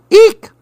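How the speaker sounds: high, loud monkey eek; monkey shriek. Acted. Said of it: "went the monkey".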